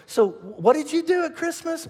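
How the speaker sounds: high, drawn-out casual question; curious questioner. Acted. People asking a churchgoer about Christmas, high-pitched questioner. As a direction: ask slowly, pitched well up, as someone else. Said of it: "people look at you and go".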